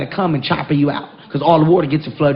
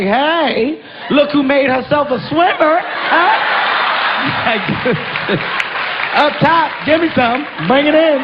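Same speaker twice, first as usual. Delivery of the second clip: mock cheer; 8 semitones higher, slower than usual. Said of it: "I was like".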